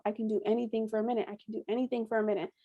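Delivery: repeating a coping mantra under breath; fast quiet mantra. Retold or imitated. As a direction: chant it quickly to get through pain. Mantra repeated through contractions.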